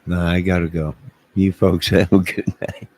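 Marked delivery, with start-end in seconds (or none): none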